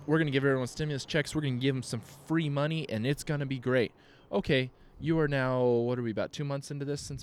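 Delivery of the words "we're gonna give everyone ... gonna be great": mock official voice. Mocking government promise.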